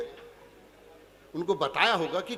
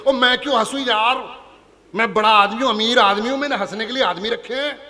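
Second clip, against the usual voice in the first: arrogant rich man; 2 semitones higher, 6 dB louder than usual. Retold or imitated. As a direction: brag like a bored rich man. Rich man voiced in joke.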